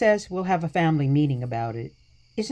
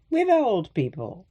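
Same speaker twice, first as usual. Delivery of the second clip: laughing; 4 semitones higher than usual. Acted. Grandma laughs about moving out.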